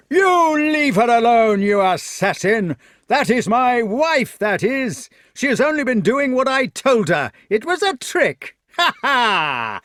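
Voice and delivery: high, very loud ranting villain; screamed out. A narrator's reading. Fat knight to the man who fell for his trick, screaming. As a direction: scream it in gloating fury. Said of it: "The fat man screamed out".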